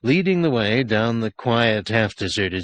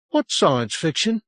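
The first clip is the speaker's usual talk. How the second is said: put-on higher, puzzled boy's question; curious boy; 8 semitones higher than usual. A narrator's reading. Lost boy asks the sergeant, higher and curious. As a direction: ask it like a wondering child. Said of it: "he asked".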